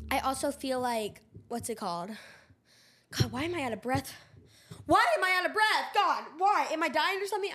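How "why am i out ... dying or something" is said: high, loud, exaggerated worry; mock alarm. Real, not acted. Asking why she is out of breath, mock alarm. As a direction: blurt it out in dramatic panic.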